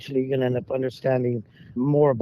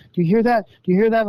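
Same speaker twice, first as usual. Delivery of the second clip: high, louder mock alarm at a howl; imitating scared campers; 8 semitones higher, 5 dB louder, faster than usual. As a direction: echo the frightened campers asking twice. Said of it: "looking at me all scared".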